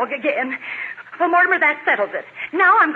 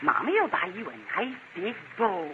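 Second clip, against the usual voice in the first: soft doting; 8 semitones lower, 4 dB quieter, slower than usual. Acted. Mother pets the dog.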